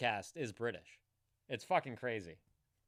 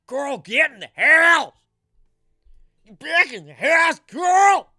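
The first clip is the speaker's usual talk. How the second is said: very high, loud yell of Coral; shouted Coral with a Rick accent; Rick calling Carl; 12 semitones higher, 16 dB louder than usual. Retold or imitated. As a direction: call out Coral across a yard, frantic. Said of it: "he said Carl weird too".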